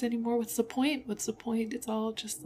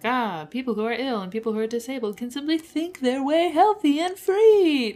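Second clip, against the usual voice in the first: louder, building to a high, loud peak on free; mocking caricature; 5 semitones higher, 9 dB louder than usual. Disowning a view she rejects to viewers, mocking caricature. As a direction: parody a smug wellness believer, swelling up. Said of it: "I don't want anyone to think that I'm like".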